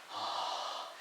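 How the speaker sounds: long voiceless open-mouthed exhale; blowing hot air. Retold or imitated. Student obeying his teacher, breathing hot air out. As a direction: huff warm breath into your hand.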